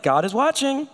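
high, a hint of a stern watcher; mock stern God voice. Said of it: "we say, maybe in our own heads".

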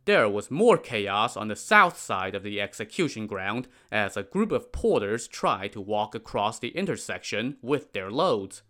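ordinary delivery, nothing marked

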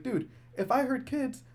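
high-pitched, insistent reasoning; imitating exasperated pleading. Dentist reasoning with scared kid, high exasperated pleading.

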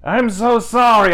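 loud, high, drawn-out apology; slow, high cry of apology; wailed apology. Acted. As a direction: wail it out, hammed-up. Drunken character apologising loudly to Oliver.

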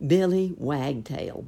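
usual speaking voice

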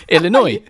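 Illinois said the French way; French-style Illinois. Shown as a demonstration. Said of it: "that's how it's said".

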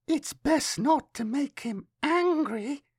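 put-on very high, slow child voice; child's warning, drawn out and rising at the end; little girl. A narrator's reading. Girl warns Matthias about her friend, high slow child voice.

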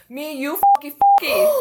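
loud, high imitation of an angry man; mock angry. Storyteller acting out the rejected drunk man, mock angry yell. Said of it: "he got really, really angry".

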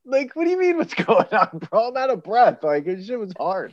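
put-on high, panting, worn-out kid; breathless exhausted kid. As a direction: gasp it out like a wiped-out kid. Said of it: "they were like".

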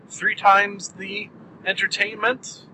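"entertainment" lifted into an unsure question. Shown as a demonstration. Host doubting his show's appeal, questioning rise on "entertainment". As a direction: raise the end of "entertainment" with zero confidence. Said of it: "quotes and a question mark on the end of that".

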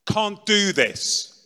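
high, slow, loud and drawn-out; terrified cry. Retold or imitated. Terrified on the floor, crying out slowly to the ceiling. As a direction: drag it out, terrified and broken.

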